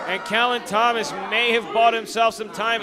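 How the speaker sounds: ordinary talk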